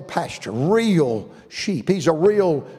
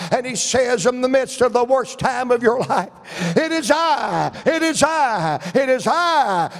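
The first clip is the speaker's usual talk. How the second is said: full-force preaching; 5 semitones higher than usual. Real, not acted. Preacher proclaiming the Savior's voice to the congregation, impassioned. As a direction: drive each phrase upward to a climax.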